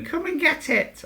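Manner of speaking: very high, slow sing-out lure; teasing taunt. Retold or imitated. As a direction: dangle the bait in a drawn-out high voice. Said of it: "you're dangling this little carrot going".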